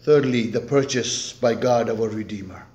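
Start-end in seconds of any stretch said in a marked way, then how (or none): 1.9-2.7 hushed refrain. Acted.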